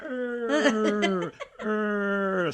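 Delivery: high, squeezed "Eh" imitating a programmer; mock the coworker's filler with a pinched, high "Eh"; Eastern European coworker impression. Recalling the friend's impression of a coworker, high pinched "Eh". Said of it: "this funny impression of".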